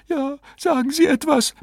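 very high, imploring woman's voice; pleading. A narrator's reading. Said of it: "bat Misses Farnsworth".

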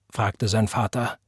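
ordinary delivery

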